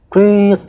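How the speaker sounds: high whistle blast; mock whistle prit, high; whistle imitation. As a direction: blow a sharp whistle sound. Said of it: "gitu kan".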